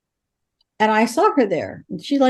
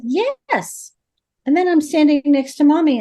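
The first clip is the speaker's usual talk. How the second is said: high-pitched imitation of a young child; little girl; 5 semitones higher than usual. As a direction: answer as a small girl, pitched well up. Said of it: "a little girl who's seven years old".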